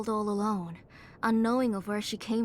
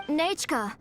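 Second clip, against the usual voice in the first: raised voice carrying to another room; calling out; 5 semitones higher than usual. Acted. Mother calling to daughter in bed.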